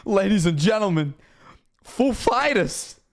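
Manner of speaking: copied announcer impression, high stretched "Fighters"; pause, then draw "Fighters" out high and falling; Christopher Walken. Streamer echoing the clip he loves, imitated Walken intro. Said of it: "doing the impression".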